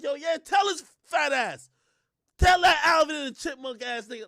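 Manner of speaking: loud, high-pitched imitation of a crowd; mock cheering on. Everybody cheering Meg's fat insult, mock jeering. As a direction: egg her on at a shout. Mimics crowd egging her on.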